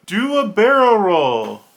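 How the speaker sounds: high, loud, slow drawn-out shout in a different voice; screamed command. Retold or imitated. Punched guy screaming to the new pilot, slow loud shout. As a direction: bellow it slowly like a wild cheer. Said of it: "screams out".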